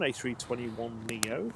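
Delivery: low, slow and final; mic drop delivery. Pretend. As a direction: drop it like a closing line. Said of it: "He says, dropping his microphone".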